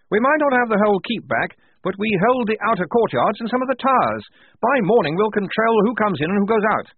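high, loud hearty officer voice; blustering major. Acted. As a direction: boom out like a jolly old officer.

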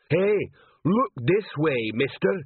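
slow, high troll call. A narrator's reading. Troll sergeant baiting the golem king, slow. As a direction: lure the golem round. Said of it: "said Detritus".

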